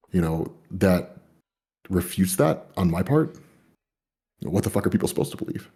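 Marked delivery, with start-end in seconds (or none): none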